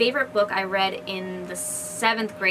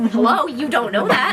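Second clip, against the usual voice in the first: laughing, mock-indignant hello; amused comeback with a laugh; laughing retort; 2 semitones higher, 5 dB louder than usual. Real, not acted. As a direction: scoff it through a laugh.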